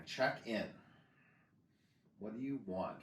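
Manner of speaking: usual speaking voice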